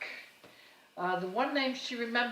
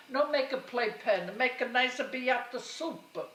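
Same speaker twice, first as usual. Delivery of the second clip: put-on mother's accented voice; 6 semitones higher, 4 dB louder than usual. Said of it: "so it would come out".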